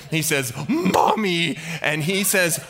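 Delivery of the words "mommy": put-on higher, louder childlike wail; hammed-up cry for help; mock panic. Jehoshaphat realising he is the target, mock-panicked cry. King panicking in battle, cries out.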